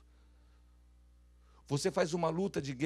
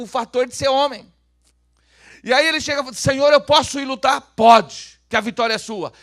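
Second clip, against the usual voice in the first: loud, raised preaching voice; full-force preaching; 8 semitones higher, 14 dB louder than usual. Real, not acted. Preacher retells David asking the Lord before battle, full-force preaching. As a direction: belt out the story to the room.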